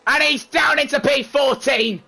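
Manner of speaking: high, loud commentator yelling at the crash; excited shouting. Real, not acted. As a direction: scream the chaos like a goal call. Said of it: "How do you not lose your voice".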